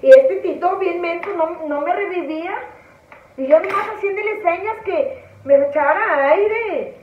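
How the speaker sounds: usual speaking voice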